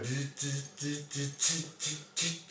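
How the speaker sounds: soft robotic zzz buzzing. Retold or imitated. Robot performer buzzing softly at a diner. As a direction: buzz like a creepy robot.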